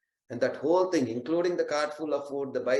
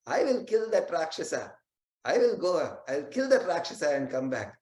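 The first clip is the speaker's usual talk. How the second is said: put-on higher, childlike boast; little boy's brave voice; 4 semitones higher than usual. Little boy comforts crying parents, brave and higher. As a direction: play the small boy promising to slay the demon. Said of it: "and says".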